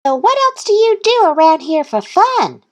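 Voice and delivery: high, loud little-duck quack. A narrator's reading. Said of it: "quacked Thistle".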